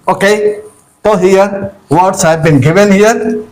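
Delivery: ordinary talk, nothing marked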